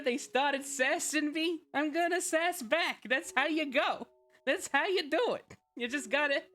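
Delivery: cartoonish New York tough-guy accent, taunting new viewers; New York alligator voice. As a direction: sass back in a hammed-up New York accent. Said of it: "talk in my New York alligator voices".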